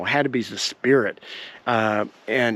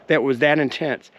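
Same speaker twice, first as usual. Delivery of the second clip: usual speaking voice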